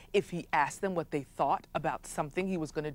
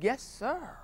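fawning courtiers; 6 semitones higher, 7 dB quieter than usual. Acted. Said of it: "They said".

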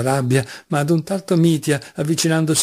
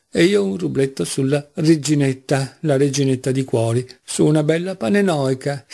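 ordinary delivery

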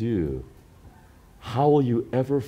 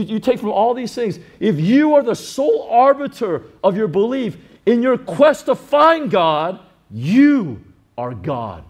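rising, louder, building to a shout; preaching full force; 7 semitones higher, 5 dB louder than usual. Retold or imitated. Pastor lecturing the man, loud and forceful. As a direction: hammer it home, getting louder.

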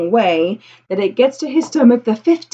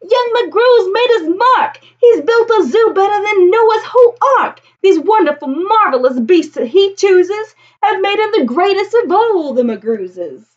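praising crowd; 9 semitones higher, 4 dB louder than usual. Acted. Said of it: "the whole world will say".

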